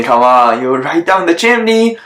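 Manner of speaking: plain reading voice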